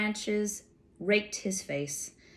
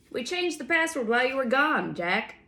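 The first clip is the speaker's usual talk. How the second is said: high sentry voice; 6 semitones higher, 4 dB louder than usual. A narrator's reading. Sentry answers from the dark.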